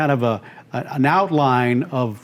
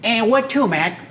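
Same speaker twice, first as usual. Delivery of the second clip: higher-pitched Brooklyn cabbie voice; Brooklyn cab driver; 7 semitones higher than usual. Retold or imitated. Cab driver to his passenger, Brooklyn accent. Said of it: "with this Brooklyn accent".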